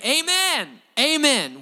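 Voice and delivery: amen thrown out high and loud; emphatic amen. Retold or imitated. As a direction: give it everything, a full-hearted amen. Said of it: "it was an emphatic amen".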